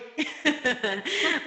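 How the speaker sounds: a quiet knowing chuckle; brief embarrassed giggle; soft laugh. Real, not acted. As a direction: laugh softly at yourself. Laughs at relatable question.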